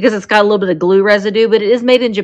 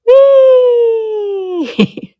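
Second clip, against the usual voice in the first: playful whee; 12 semitones higher, 4 dB louder than usual. Pretend. Playing with toy digger.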